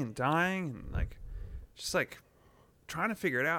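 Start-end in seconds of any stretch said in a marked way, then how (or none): none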